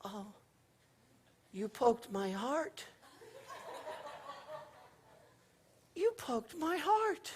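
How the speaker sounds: put-on soft, slow, wounded little boy voice; hurt little boy. Little boy hurt that the girl poked him, soft and slow. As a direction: sound heartbroken over a tiny poke. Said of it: "he's like".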